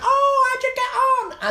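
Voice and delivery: very high, loud, slow eager call; squeaky high excited housemate voice; flatmates' voice. Retold or imitated.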